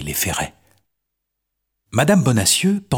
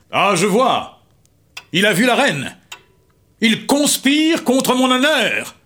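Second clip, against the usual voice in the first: high, furious outcry; a jealous king exploding with rage; angry king; 13 semitones higher, slower than usual. Acted. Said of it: "Le roi s'écrie, rouge de colère".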